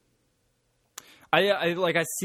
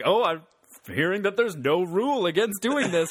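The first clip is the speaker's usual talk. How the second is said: higher, louder imitation of a sports commentator; mock commentator; 8 semitones higher, 5 dB louder than usual. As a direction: announce it like a commentator passing on news. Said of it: "it is explained by the commentator".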